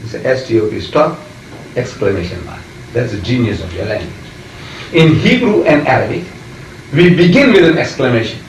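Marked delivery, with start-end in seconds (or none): none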